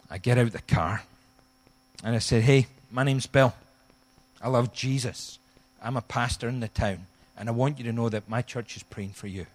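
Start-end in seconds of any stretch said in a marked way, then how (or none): none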